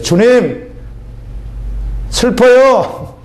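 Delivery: a drawn-out, high plea; imitating his own crying prayer, slowly; slow, pleading cry to the Lord; retold tearful prayer. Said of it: "나 혼자 그러면서 막 울었어요".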